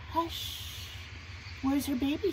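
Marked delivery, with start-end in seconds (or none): 0.1-0.6 soft, higher shush; mama's hush. Acted. Mama hushing the mosquito, softly. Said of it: "She says to the mosquito".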